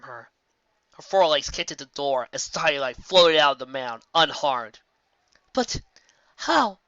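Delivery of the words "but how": 1 shocked. A narrator's reading. Nightmare Moon shocked.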